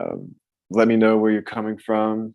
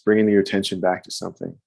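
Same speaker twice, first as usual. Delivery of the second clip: ordinary delivery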